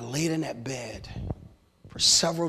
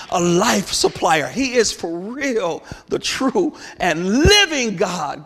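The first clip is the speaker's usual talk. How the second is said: rising, louder declaration, preacher's crescendo; full-force preaching; 5 semitones higher, 4 dB louder than usual. Acted. Preacher proclaiming to the church, building loud. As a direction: drive the testimony home to the congregation.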